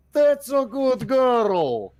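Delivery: high, loud, drawn-out cooing praise; Jim Jones. Acted. Player as his character leans over to praise, exaggerated cooing. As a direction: stretch it out loud and high, hammed up. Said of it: "Jim Jones leans over".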